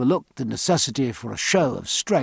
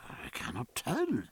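whisper; 3 semitones higher, 10 dB quieter than usual. Acted. Stephen hedging, whispered.

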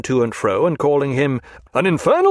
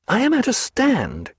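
Stephen at a loss; 11 semitones higher than usual. A narrator's reading. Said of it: "he said".

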